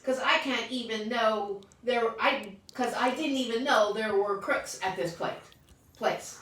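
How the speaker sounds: high, loud indignant ranting; child outraged about crooks at school; upset complaint. Acted. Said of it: "upset child complaining".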